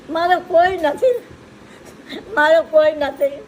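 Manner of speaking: pleading through tears, voice climbing; tearful plea. Real, not acted. Old woman begging the host to take her in, tearful.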